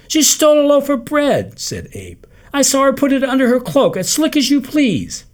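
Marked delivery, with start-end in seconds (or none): 0.1-1.6 much higher, louder tattling; excited report. A narrator's reading. Abe reporting the theft to Jake, much higher and louder. 2.5-5.2 much higher, louder excited report; excited tattling. A narrator's reading. Abe reporting the theft to Jake, much higher and louder.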